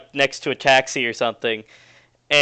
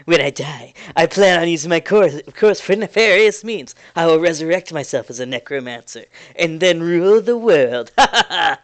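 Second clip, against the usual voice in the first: louder, gloating plan ending in a villain laugh; villainous boast; 2 semitones higher, 4 dB louder than usual. Acted. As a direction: rub your hands and gloat. NPC boasts of necromancy plans.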